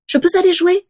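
put-on very high child's voice; little girl. A narrator's reading. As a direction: ask like a small child wanting to play. Little girl asks her father.

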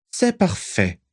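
high-pitched, measured villain; Voldemort. A narrator's reading. Voldemort approving a follower's plan, high commanding voice. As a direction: speak high, with cool command.

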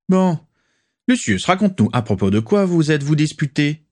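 voix plus aiguë, pressante; le pousser à se confier; Rosier curieux. Acted. Rosier presse Lucius de parler. (Rosier presse Lucius de raconter, aigu et curieux)

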